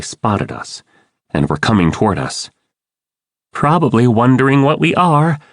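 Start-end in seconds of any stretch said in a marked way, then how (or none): 3.5-5.4 higher-pitched, mildly cautious question. A narrator's reading. Cassie wonders how the Lyrans see the sharks, mildly cautious. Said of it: "Cassie said cautiously".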